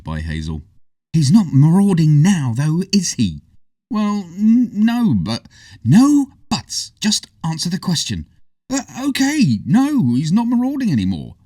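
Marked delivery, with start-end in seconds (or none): 1.1-3.4 put-on stern girl. A narrator's reading. Stern Hazel. 3.9-5.5 put-on stammering boy. A narrator's reading. 5.8-8.3 put-on stern girl. A narrator's reading. Stern Hazel. 8.7-11.4 put-on sulky boy. A narrator's reading. Boy giving in.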